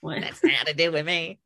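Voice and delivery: southerner echoing a Northern accent; imitated Northern accent. Shown as a demonstration. Repeating after the northerner, imitated Northern accent. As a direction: have another go at Northern vowels. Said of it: "not Northern".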